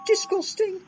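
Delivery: squeaky disgust. A narrator's reading. Said James.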